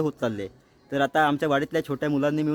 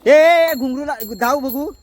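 loud, high drawn-out call; long raised-voice holler; 9 semitones higher, 8 dB louder than usual. Real, not acted. Calling out to the children.

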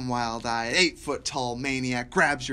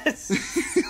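ordinary talk

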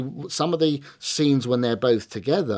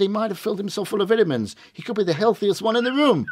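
mock worry; 10 semitones higher, 4 dB louder than usual. [name] joking about vitamins.

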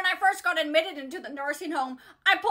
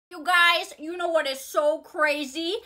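ordinary talk

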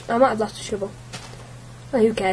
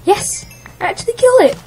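excited yell; 12 semitones higher, 9 dB louder, faster than usual. Real, not acted. Just beat a monster in game.

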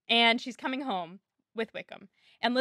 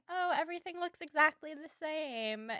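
higher, soft, catty mock remark; imitating a snooty put-down; bitchy; 5 semitones higher, 8 dB quieter than usual. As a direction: put on a snide, high little voice. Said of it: "that bitchy way that you do sometimes".

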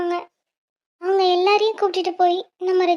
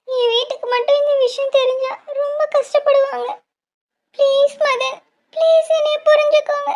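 desperate pleading; 7 semitones higher than usual. Acted. Girlfriend begs lover to understand.

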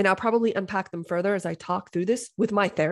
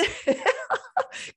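high laugh. Real, not acted. Laughs at own retort.

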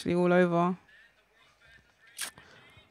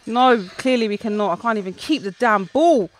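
ordinary talk

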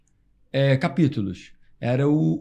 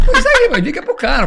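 laughing; 13 semitones higher, 9 dB louder than usual. Real, not acted. Laughing while retelling the call.

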